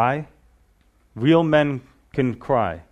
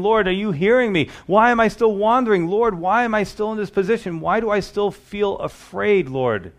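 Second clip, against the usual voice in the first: pleading prayer; 7 semitones higher than usual. Acted. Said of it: "we're crying because we're saying".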